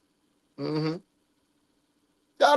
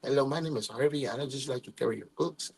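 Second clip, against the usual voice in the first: soft, much lower mock nerd voice; shy nerdy boy; 9 semitones lower, 13 dB quieter than usual. Imitating Harvey offering to carry her books, soft and low. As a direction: play a timid boy introducing himself, hushed and low. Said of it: "little Migo that said".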